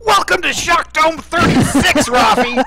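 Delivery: high, loud, drawn-out holler; mock announcer yell. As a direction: belt out the catchphrase at a friend. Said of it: "it's the same thing where it's like".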